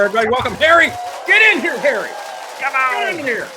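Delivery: loud, high hype-man welcome. Real, not acted. Host hyping the guest's arrival, loud and high. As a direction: pump up the crowd for the guest.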